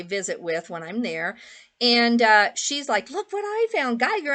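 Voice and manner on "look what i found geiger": excited. Retold or imitated. Friend excitedly shows her find.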